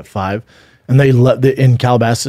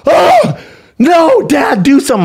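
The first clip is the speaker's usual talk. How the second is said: very high-pitched mock child's cry of alarm; panicked child; 4 dB louder, slower than usual. Son seeing baby turtle attacked, imitated panicked plea. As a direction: imitate a horrified kid pleading for help. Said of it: "Tiger goes".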